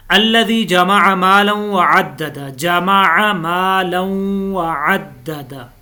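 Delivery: slow Quranic recitation with ghunna. Shown as a demonstration. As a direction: draw out the nasal on Malan. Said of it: "मालन के ऊपर गुन्ना है".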